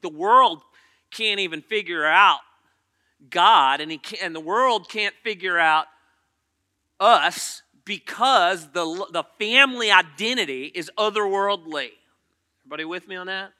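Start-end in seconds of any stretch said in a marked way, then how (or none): 0.0-11.9 louder preaching, leaning on each phrase. Acted. Preacher telling the church the world can't figure them out, preaching louder. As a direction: drive the point home to the room. Preacher stressing point loudly.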